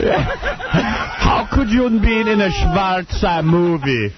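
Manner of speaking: put-on slow, high Yiddish-accented shout. Mock character, shouting.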